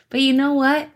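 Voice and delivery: ordinary delivery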